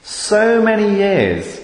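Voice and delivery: high anguished cry of lament. Acted. Prophet crying out to God in anguish. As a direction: plead with God, high and aching. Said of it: "Habakkuk cries out in verse two".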